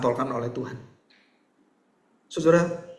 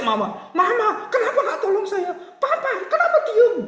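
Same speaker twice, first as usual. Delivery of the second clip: put-on very high, wailing child voice; child crying out. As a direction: wail for mama and papa like a child. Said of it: "Terus dia teriak". (anak merengek memanggil orang tua)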